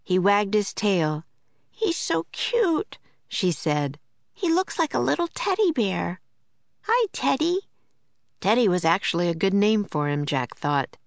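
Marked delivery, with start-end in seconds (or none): 1.7-7.6 put-on cooing little girl. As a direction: coo over the puppy like a young girl. Girl cooing over a puppy.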